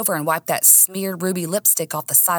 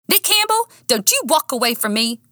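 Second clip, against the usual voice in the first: high-pitched, scolding command; angry call; 6 semitones higher than usual. A narrator's reading. Woman ordering Nick not to walk away, indignant. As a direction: call him back sharply, pitched high. Heroine calls after departing boyfriend.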